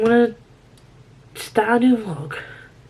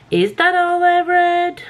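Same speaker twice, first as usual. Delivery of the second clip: surprised; 12 semitones higher, 4 dB louder, slower than usual. Real, not acted. Checking what she read.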